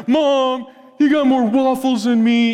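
lightly voiced kid complaint; complaining kid. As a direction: tattle to mom like a little kid.

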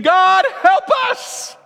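high, loud, slow scream of God, help us. Retold or imitated. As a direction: cry God, help us in full panic. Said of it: "stand up and scream".

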